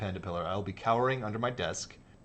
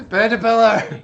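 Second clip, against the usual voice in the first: high, very loud shriek; 12 semitones higher, 17 dB louder than usual. Real, not acted. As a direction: shriek the name in horror. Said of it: "Literally shrieking".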